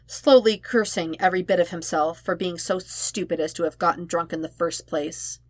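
ordinary delivery, nothing marked

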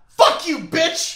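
high, loud jeering shout; mock-angry holler; mock group yell. Repeats the acted crowd yell.

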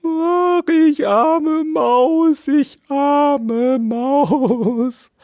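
high, slow sobbing wail of a tiny mouse; drawn-out squeaky lament. Acted. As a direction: moan it like a pitiful little mouse. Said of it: "klagte Mariechen Knusperkorn und schluchzte beweglich".

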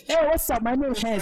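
high, loud, brash hello; loud yelled greeting. Shown as a demonstration. Speaker shows how her family greeted, loud high yell. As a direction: bark the greeting like a shouting family.

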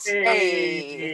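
sound effect shout. Pretend. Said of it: "Sound effect".

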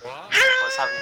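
loud shout over noise; shouting to be heard. Real, not acted. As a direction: yell so they can hear you. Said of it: "I can't hear you".

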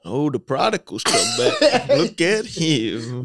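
slow, drawn-out mock welcome; teasing church folk. Church folk teasing the returning prodigal, slow mock. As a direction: drag out the teasing greeting. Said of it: "They was like".

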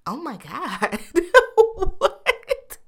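high squealing laugh into a stunned what; laughing disbelief. Real, not acted. Podcaster laughing at a three hundred dollar car wash.

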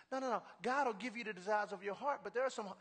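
ordinary talk, nothing marked

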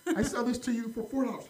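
high-pitched haggling offer; pushy vendor. Retold or imitated. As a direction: imitate an insistent street seller. Acted haggling with pushy street vendor.

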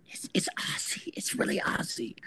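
awed, unvoiced whisper; stunned whisper to the girl in bed. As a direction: breathe it so only she hears.

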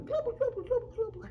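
high, loud, rolling gobbles; turkey gobble. Acted. Turkey lurkey stops the runners, gobbling. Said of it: "The turkey lurkey said".

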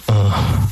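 low, deep groan of disgust; mock disgusted groan; disgusted ugh. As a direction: sound fed up and repelled. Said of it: "like, go".

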